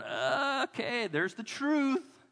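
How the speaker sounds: high, drawn-out groaning dismay; mock dismay. Middle-aged man at the morning mirror, mock dismay. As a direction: groan it out, stretching the last word.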